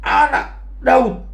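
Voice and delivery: high, drawn-out retold shout; Bartimeus berteriak kencang. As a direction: imitate an insistent cry for attention. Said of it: "dia malah berteriak lebih kencang". (seruan lantang bernada tinggi menirukan Bartimeus)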